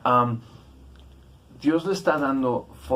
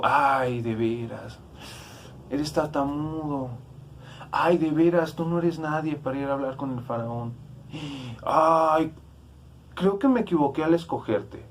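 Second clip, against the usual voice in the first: hammed-up, sighing "ay" in a raised voice; mocking exasperation; 4 semitones higher than usual. What God did not tell Moses, mock exasperated put-down. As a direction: scoff as if fed up with him. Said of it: "Dios no le dijo".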